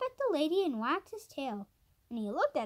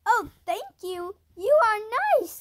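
grateful cry, building louder and higher; excited cry; 7 semitones higher, 8 dB louder than usual. A narrator's reading. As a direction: cry out your thanks, rising to a loud, high finish. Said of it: "cried Benny".

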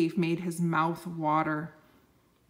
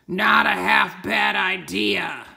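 slow, louder dragon growl; 7 dB louder, slower than usual. Acted. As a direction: rumble it like a hungry dragon. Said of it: "he growled".

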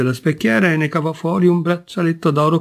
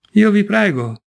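a sobbing plea, pitch breaking upward on "Io"; through tears; 8 semitones higher than usual. A narrator's reading. Wife begging the singer to save her husband, tearful. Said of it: "si udì fra le lacrime".

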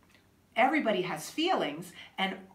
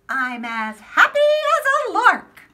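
higher and louder, cheerful; happy; 9 semitones higher, 10 dB louder than usual. Acted. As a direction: sing it out like a lark. Reading happy line.

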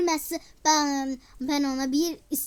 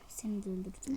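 soft whispered gloat; whispering; 5 semitones lower, 10 dB quieter, faster than usual. Real, not acted. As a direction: breathe it out in a whisper. Quiet gloating while playing. (fısıltıyla)

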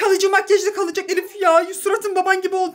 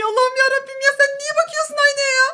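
panicked protest; 5 semitones higher, faster than usual. Acted. Wife as husband reaches the mirror, panicked high protest. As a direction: protest helplessly, pitch climbing and staying up.

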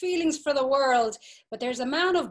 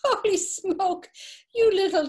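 mock surprise; 8 semitones higher, 5 dB louder than usual. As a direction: yelp it out in theatrical shock. Whoremistress exclaims in mock shock.

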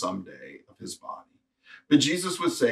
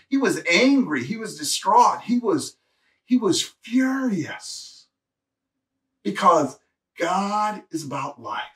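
rising preaching fervor; 7 semitones higher, slower than usual. Real, not acted. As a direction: build it up to a furious peak. Preacher building about Jesus' anger.

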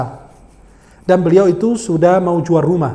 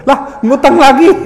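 high, loud, drawn-out mock outcry; exasperated disbelief; 7 semitones higher, 7 dB louder, slower than usual. Preacher hearing the debtor has borrowed again, exasperated disbelief. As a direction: blurt it out high and loud, incredulous.

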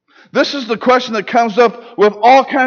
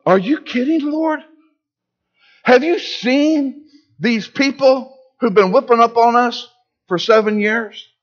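higher, disbelieving, a touch of mockery; incredulous protest; 4 semitones higher than usual. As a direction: push back like he can't take it seriously. Gideon protesting to the Lord.

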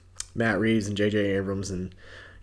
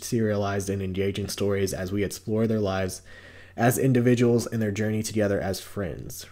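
ordinary talk, nothing marked

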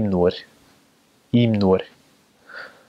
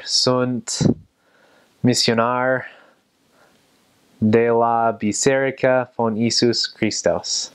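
slow, halting Romanian in a heavy American accent; drawn-out, word-by-word American-accented Romanian; bad American accent; 2 semitones higher, slower than usual. Shown as a demonstration. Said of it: "very bad ... American accent in Romanian".

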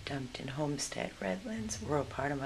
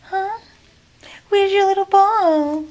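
loud, much higher sing-song pet voice; 20 dB louder than usual. Real, not acted. Rescuer calling to a frightened puppy in loud, high baby talk.

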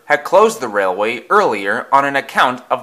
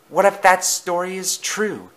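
higher, worried question; fretful, pitched-up character voice; Percy; 7 semitones higher than usual. A narrator's reading.

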